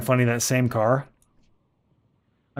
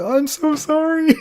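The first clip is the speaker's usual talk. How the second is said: very high, drawn-out squealed apology; hammed-up apology; 5 dB louder, slower than usual. Apologizing to the yelling owner, hammed-up high plea. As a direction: plead in a mock high voice, near laughter.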